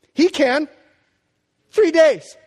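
sudden loud, high proclamation; emphatic shout. Real, not acted. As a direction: burst out with it, preaching hard. Preacher's emphatic punch line.

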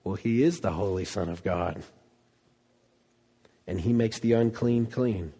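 ordinary talk, nothing marked

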